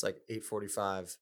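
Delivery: normal talking voice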